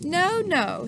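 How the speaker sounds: usual speaking voice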